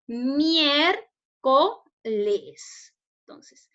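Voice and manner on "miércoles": "Miércoles" slowly split into mier, co, les; syllable by syllable. Shown as a demonstration. Teacher divides the example for pupils, "Miércoles" syllable by syllable. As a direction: say "Miércoles" as three separate syllables with clear gaps. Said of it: "lo vamos a dividir en sílabas".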